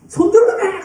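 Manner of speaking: high, loud mock complaint; mock protest. Drummer yelling back at the worship leader, high pitched protest. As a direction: whine it like the frustrated drummer.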